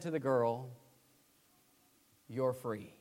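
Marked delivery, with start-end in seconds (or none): 2.3-2.9 soft, lower, calm words; gentle quiet. Retold or imitated. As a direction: quietly give her the news. Freed man tells girl gently.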